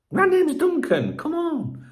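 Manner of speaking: high, slow put-on Glaswegian accent; mock Glaswegian. Grandson of a Glaswegian taking the piss, high Glaswegian accent. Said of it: "my granddad was from Glasgow".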